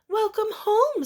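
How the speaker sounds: high bright welcome; cheerful father. Acted. Father welcoming his daughter home, high and bright. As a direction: greet her like a happy dad.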